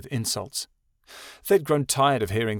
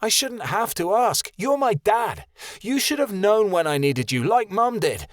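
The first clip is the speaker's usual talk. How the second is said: higher, louder furious yell; shouting; 10 semitones higher, 3 dB louder than usual. A narrator's reading. Son screaming at his father, furious. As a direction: scream the hurt at your dad. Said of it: "Keanu shouted".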